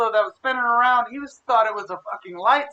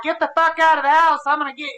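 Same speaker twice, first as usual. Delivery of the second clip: higher, lightly hammed-up bossy mom; brash mom, imitated; 5 semitones higher, 5 dB louder than usual. Mom kicking son out on New Year's, brash mock order. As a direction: boot the kid out, playfully loud.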